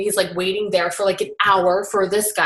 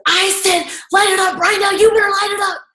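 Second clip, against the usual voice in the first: much higher and louder, a raging shout; furious yelling; 12 semitones higher, 6 dB louder than usual. Retold or imitated. Tony yells at the smoker to light up, furious shout. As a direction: scream it right in his face. Tony gets in his face.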